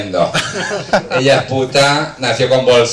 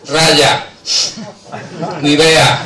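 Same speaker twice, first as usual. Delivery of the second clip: ordinary talk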